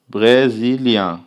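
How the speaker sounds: "brésilien" stretched very slowly, nasal end; teacher demo of French nasal ending; slow French word. Shown as a demonstration. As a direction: draw out "brésilien" syllable by syllable. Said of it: "Je suis".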